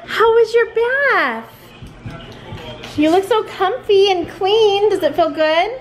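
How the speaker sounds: high, sing-song greeting to a little one; sweet parent talk. Real, not acted. Parent greets child fresh from the bath, doting.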